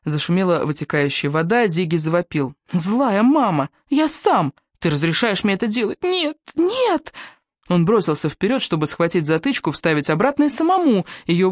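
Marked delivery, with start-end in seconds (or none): 2.7-7.1 high slow wailing tantrum; child's tantrum. A narrator's reading. Little boy wails at his mother during a bath tantrum. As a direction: howl it like a furious toddler.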